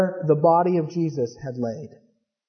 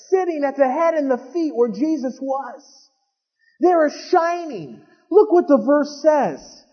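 high, fervent preaching; preacher in full flow, pitch raised; 6 semitones higher than usual. Acted. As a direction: drive the story home to the crowd.

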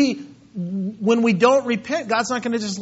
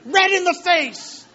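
shouting, much higher, hammed-up angry dad; mock enraged father yelling; furious earthly father; 7 semitones higher than usual. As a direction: rant like a fed-up father sending a kid away. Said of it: "He's not like".

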